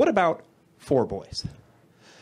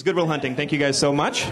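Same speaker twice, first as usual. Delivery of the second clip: usual speaking voice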